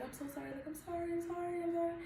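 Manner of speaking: high, hushed rapid sorrys; frantic apologizing. Retold or imitated. New mom apologizing to diners for crying baby, hushed and high. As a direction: imitate her anxious repeated apology. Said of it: "But I still was just like".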